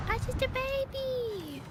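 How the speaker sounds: put-on high, very slow whiny baby voice. Player mocking fear as an enemy closes in, baby voice. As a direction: squeak it out like a helpless baby. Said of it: "This guy's coming right for me".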